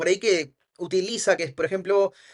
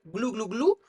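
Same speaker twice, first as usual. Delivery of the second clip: imitated glug-glug of drinking; gulping noise; 3 dB quieter, slower than usual. Trainer mimics salesman drinking on a call, gulping. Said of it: "escuchar a alguien hacer".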